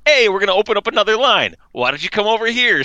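lightly voiced security officer; cheerful agent voice. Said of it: "They're like".

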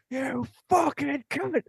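high, slow furious yell; girlfriend's road rage. Retold or imitated. Boyfriend mimics girlfriend screaming at a driver, high drawn-out yell. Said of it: "all I hear is".

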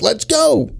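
high, taunting yell-ish retelling; mock tough challenge. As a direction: imitate a guy itching to fight. Quoted guy challenging partner.

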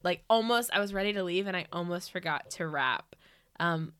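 usual speaking voice

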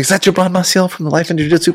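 mock starstruck fan. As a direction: gush fast with a laugh, teasing the newbie. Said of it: "He's like".

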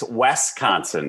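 "Wisconsin" said as "WES-kahn-sin"; wrong pronunciation. Shown as a demonstration. Local mocking a worse mistake, "Wisconsin" as "Wes-consin". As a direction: put an E in the first syllable of "Wisconsin". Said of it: "Do you see an E in there?".

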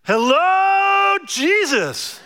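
loud, high, drawn-out hello; kid yelling out. Acted. Little boy greets Jesus in the middle of church, yelling out. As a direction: call it out like an excited little kid. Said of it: "the little kid in front of Padraig yells out".

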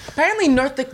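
ordinary talk, nothing marked

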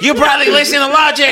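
high, loud, laughing jab; laughing mock insult. Friend jokes what the stranger might say, laughing. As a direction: crack up while delivering the diss. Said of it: "joking imitation of stranger".

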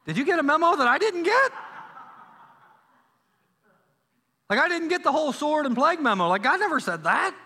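Aaron can't believe what Moses just added; incredulous Aaron. Acted. Aaron reacts to Moses' claim.

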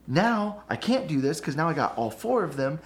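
pissy indignation. Retold or imitated. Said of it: "I'll get all pissy about it".

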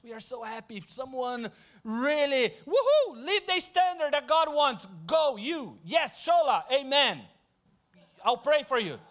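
loud mock cheering from the sidelines. God mocks cheering others on, hammed-up and loud. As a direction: cheer someone else on, hammed-up. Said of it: "God really spoke to my heart".

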